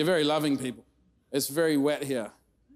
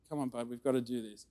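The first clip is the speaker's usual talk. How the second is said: gentle coaxing; 3 semitones lower, 9 dB quieter, faster than usual. Retold or imitated. Dad coaxing son gently.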